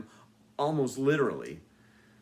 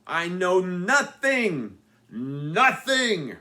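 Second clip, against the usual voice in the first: put-on slow, loud, higher comic accent; weird accented voice; 6 semitones higher, 7 dB louder, much slower than usual. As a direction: ham it up like a bumbling foreign guard. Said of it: "in another weird voice".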